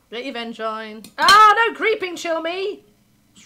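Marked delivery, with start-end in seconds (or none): 1.2-2.8 much higher, louder cry of dismay; pained yell. Real, not acted. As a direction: yell at the unlucky card. Opponent plays a bad card.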